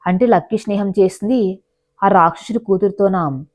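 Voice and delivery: ordinary delivery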